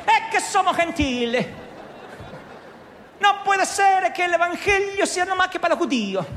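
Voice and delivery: put-on Italian accent. Preacher imitating an Italian centurion praying, Italian accent. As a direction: ham up an Italian praying to God. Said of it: "oraba a lo tano, a lo italiano".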